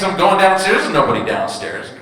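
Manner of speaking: ordinary talk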